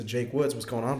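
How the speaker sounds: ordinary talk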